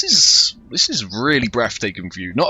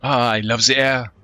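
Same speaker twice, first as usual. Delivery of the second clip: put-on lightly French-accented, easygoing mercenary; a breezy hint of a French rogue; French swashbuckler; 2 semitones higher than usual. The mercenary chats with Thomas on deck in French accent.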